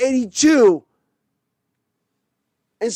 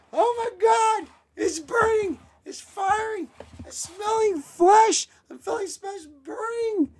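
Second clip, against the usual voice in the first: much higher crying pain voice; mock burning coach; 10 semitones higher, 4 dB quieter than usual. Fan voicing the burning coach, high mock crying. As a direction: wail like the flesh is burning.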